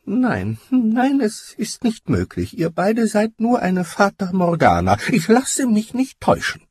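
high, thin, refusing to believe it; muttering disbelief. A narrator's reading. Bewildered giant doubting his two friends, muttered disbelief. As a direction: shake it off in a high, troubled voice. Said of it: "schüttelte er den Kopf und murmelte".

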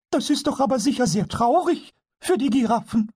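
very high kobold voice with a touch of sympathy; a tiny goblin feeling sorry for the giraffes; kobold full of pity. Acted. Pumuckl pities the giraffes to Eder, mild sympathy in a high voice. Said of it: "voll Mitgefühl".

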